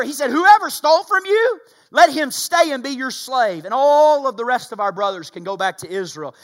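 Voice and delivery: loud, high, fervent sermon delivery; rising revival preacher cry; preaching at full force. Acted. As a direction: preach it hard to the whole room. Preacher retells Judah's plea.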